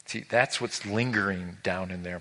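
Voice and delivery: ordinary talk, nothing marked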